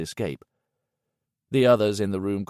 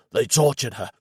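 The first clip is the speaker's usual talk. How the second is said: hoarse and unreal; 3 semitones higher, 4 dB louder than usual. A narrator's reading. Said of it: "His voice was hoarse and unreal".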